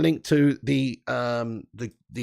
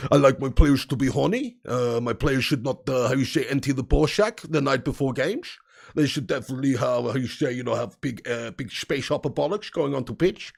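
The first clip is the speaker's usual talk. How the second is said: put-on van Gaal accent; 3 semitones lower than usual. Hammed-up van Gaal lecturing his players, Dutch accent. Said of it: "Van Gaal is easy to do".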